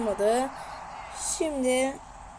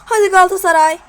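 excited cheering; 4 semitones higher, 8 dB louder than usual. Real, not acted. Urging team to shoot.

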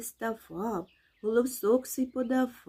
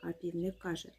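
ordinary delivery, nothing marked

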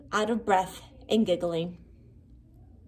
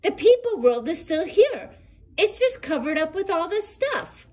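delighted monster; 8 semitones higher than usual. A narrator's reading. Hughley tells friends the world is covered, delighted. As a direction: bubble over with relief. Relieved joyful discovery.

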